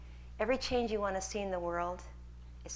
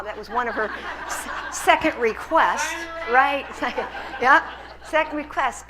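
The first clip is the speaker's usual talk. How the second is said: laughing through the words, louder; chuckling as she repeats it; amused, laughing aloud; 5 semitones higher, 12 dB louder than usual. Real, not acted. Laughing while recalling requests.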